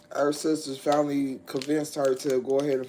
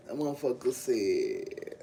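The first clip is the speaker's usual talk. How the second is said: ordinary talk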